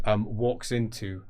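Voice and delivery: usual speaking voice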